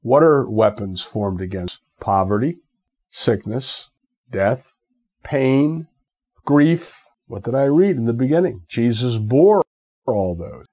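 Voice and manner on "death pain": normal talking voice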